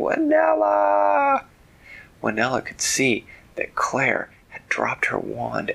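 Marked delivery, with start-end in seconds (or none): 0.0-1.4 very high, long-drawn cry for help; desperate yell. Acted. Claire yells to her friend from the dragon's claw, high wail. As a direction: stretch the name out in a high yell. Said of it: "Claire was yelling".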